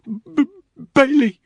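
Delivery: high, loud broken stutter; terrified stammer. Acted. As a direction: stammer in panic. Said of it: "the driver stammered".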